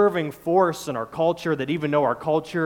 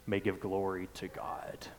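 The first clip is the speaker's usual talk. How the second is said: hushed whisper; 6 semitones lower, 12 dB quieter than usual. Real, not acted. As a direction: let the words fall to a breath. Preacher drops to a hush.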